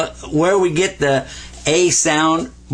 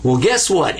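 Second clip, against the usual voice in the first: ordinary delivery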